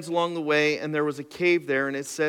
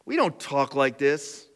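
ordinary talk

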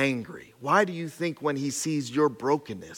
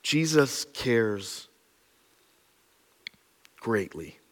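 slow emphasis; 4 semitones lower, much slower than usual. Acted. Preacher repeating the point to the church, slow and hushed. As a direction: lay each word down slowly.

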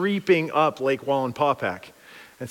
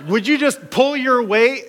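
exasperated demand; 7 semitones higher, 3 dB louder than usual. Acted. Disciples scolding Jesus as they bail.

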